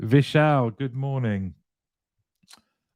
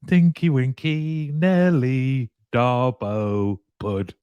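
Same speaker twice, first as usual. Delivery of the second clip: put-on children's TV presenter; 2 semitones higher, slower than usual. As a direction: playfully announce the names like a toddler TV host. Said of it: "It sounds like a children's T V show".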